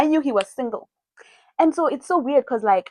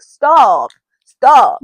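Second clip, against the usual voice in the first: retold loud pleading; 3 semitones higher, 9 dB louder than usual. The guy in the background, loud pleading. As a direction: call out to stop them. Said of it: "hear the guy is like".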